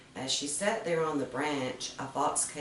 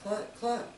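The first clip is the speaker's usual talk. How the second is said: cluck, cluck pitched much higher; high hen clucking; 8 semitones higher than usual. A narrator's reading. The other hens rush to see, high clucking. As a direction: squawk it up high like excited hens. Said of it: "cried the other hens".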